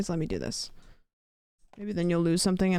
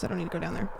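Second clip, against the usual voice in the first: put-on a light Brody accent, a bit quieter; 6 dB quieter, much faster than usual. Muttering to stream chat in Brody's accent. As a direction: slip into a friend's Brody accent. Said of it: "That's a perfect Brody accent".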